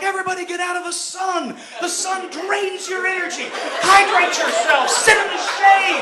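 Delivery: lightly voiced worried classmate; fussy kid voice. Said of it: "Joel starts going off".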